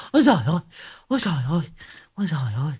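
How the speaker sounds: exasperated repeated cry to heaven; theatrical lament. Acted. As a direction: wail it out, then let it fade. Cursing woman wails between insults.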